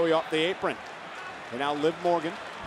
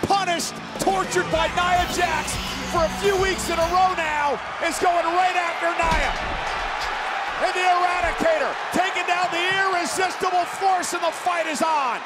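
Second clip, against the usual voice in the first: wrestling commentator; 10 semitones higher than usual. Real, not acted. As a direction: call the brawl at full energy. Live play-by-play.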